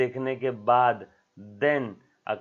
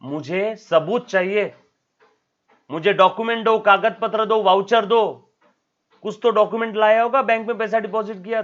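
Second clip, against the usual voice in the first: loud, higher demand for proof; insistent refusal; 8 semitones higher, 7 dB louder than usual. Retold or imitated. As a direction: refuse firmly and demand documents. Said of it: "वो क्या बोलेगा".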